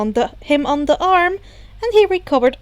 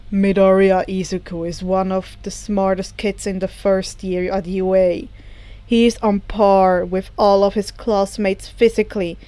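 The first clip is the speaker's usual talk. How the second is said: a lightly lower, steady teacher voice; Aizawa's voice; 4 semitones lower than usual. A narrator's reading. Aizawa answers reporters about his student, lightly lower and measured. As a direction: answer the press calmly, a touch lower.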